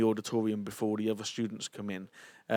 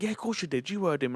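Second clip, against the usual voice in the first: higher-pitched, disbelieving protest; retold insistence; 6 semitones higher than usual. To the man who denies praying, insistent. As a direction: insist as if it were obvious.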